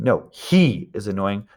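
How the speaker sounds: "he" lengthened and punched after "No"; stress on he. Shown as a demonstration. Teacher correcting who is annoying, stress on "he". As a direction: correct them by leaning on "he". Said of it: "to emphasize that you're talking about him".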